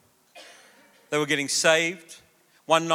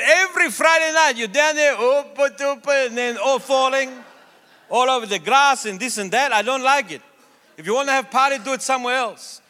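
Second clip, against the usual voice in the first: put-on complaining neighbour, higher and louder, with an accent; grumpy neighbour; 7 semitones higher, 4 dB louder than usual. Pastor retells neighbour complaining at door in a raised, accented voice. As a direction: act out the cranky old neighbour at the door.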